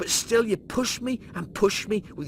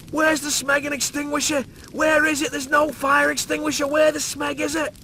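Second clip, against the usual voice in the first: high, loud desperate questions; frantic yelling; 9 semitones higher, 4 dB louder than usual. Acted. Lister hunts for the extinguisher, frantic yelling. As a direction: shout in mounting panic. Desperate search, fire spreading.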